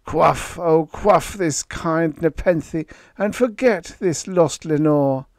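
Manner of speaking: high and loud, pleading; loud high-pitched fervent plea; crying out. Acted. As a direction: beg the bird at full voice. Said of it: "I cried".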